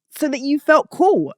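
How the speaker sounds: ordinary talk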